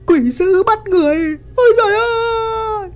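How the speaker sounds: very high-pitched screaming alarm; frantic shriek ending in a wail; terrified scream. A narrator's reading. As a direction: scream to the whole village in terror. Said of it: "bỏ cả đồ nghề rồi thét lên một tiếng".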